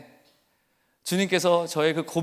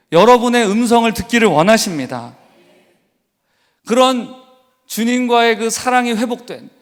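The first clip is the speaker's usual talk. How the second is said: loud, high, ringing sermon climax; full-voiced revival preaching; preaching at full force; 6 semitones higher, 10 dB louder than usual. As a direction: proclaim it to the congregation with fire.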